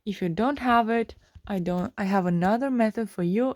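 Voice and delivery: ordinary delivery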